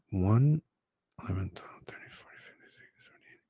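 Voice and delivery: counting under the breath; whispered counting. Real, not acted. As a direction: whisper the numbers to yourself.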